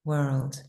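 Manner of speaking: "world" said in full, one smooth syllable; whole word. Shown as a demonstration. Teacher finishes the drill with the complete "world". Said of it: "then finish the word".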